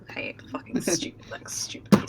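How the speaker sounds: muttered whispered cursing; cursing herself after a bad attempt. Real, not acted. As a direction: swear under your breath. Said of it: "whispered curse".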